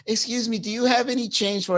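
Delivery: lightly imitated female panhandler; panhandling lady.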